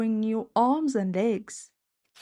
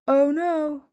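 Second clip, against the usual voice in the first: groan; 7 semitones higher, 6 dB louder than usual. A narrator's reading. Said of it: "he groaned".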